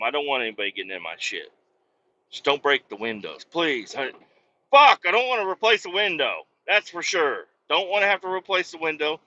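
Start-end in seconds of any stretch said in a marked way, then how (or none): none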